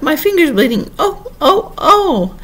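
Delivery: high-pitched wail of alarm; screaming. A narrator's reading. Queen shouts about her finger to Alice, screaming high. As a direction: cry out shrieking as if hurt. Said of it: "shouted the Queen".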